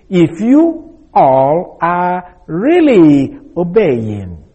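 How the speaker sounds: "If you all are really obeying" drawn out slowly, stress on "you"; slow, deliberate rendering of "If you all are really obeying"; slow emphatic reading. Shown as a demonstration. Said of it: "If we can translate it this way".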